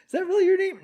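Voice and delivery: a hammed-up doubting stranger; mock disbelief. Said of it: "no one would ever think".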